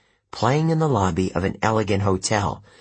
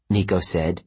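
ordinary delivery, nothing marked

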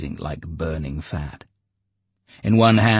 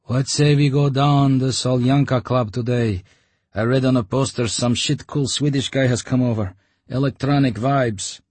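higher, louder lazy lad's voice; bored station lad; 7 semitones higher, 5 dB louder than usual. Acted. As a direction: pitch it as a slangy young guy. Station dweller chatting.

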